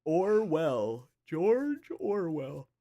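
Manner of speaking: drawn-out pun. Pretend.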